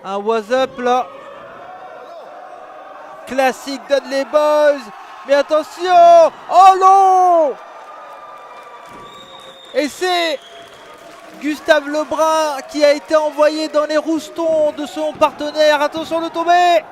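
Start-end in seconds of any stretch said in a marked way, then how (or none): 3.3-7.5 rising excited yell; excited shout. Real, not acted. Excited wrestling commentary climax. 9.7-16.8 raised excited play-by-play; excited commentary. Real, not acted.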